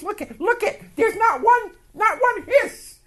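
high, loud, amazed voice with a laugh; amazed exclamation. Retold or imitated. Plumber boss marvels at no leaks, high and amazed. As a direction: marvel out loud at the dry joints.

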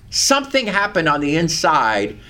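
normal talking voice

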